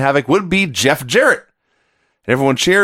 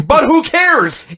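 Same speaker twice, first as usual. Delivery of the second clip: laughing; 12 semitones higher, much slower than usual. Retold or imitated. Laughing mock retort.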